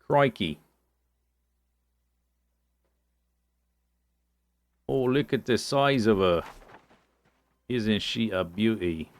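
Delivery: lightly put-on Aussie wildlife presenter; bad Australian accent. Gamer admits a poor Aussie, then tries it anyway, light Australian accent. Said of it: "I do not do a good Aussie".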